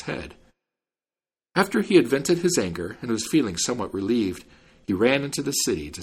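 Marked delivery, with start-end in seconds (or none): none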